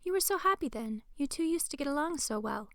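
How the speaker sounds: put-on high-pitched mother, wistful about old times; well-meaning mom. A narrator's reading. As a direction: lift the pitch for a coaxing mom.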